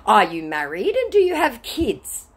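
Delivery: high put-on scammer voice; probing scammer. Scammer steering the chat back on track, high mock earnestness. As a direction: imitate a scammer fishing for details. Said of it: "Trying to get the conversation back on track".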